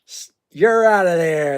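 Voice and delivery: an umpire tossing a player from the game; umpire's call. Retold or imitated. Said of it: "to quote the baseball player".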